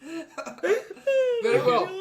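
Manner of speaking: high, slow, through laughter; laughing. Real, not acted. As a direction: say it cracking up. Laughing in character.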